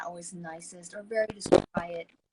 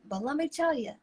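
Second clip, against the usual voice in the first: ordinary talk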